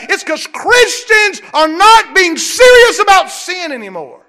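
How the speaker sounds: loud, high, rising preacher's cry; shouted sermon climax; preaching at full force. Acted.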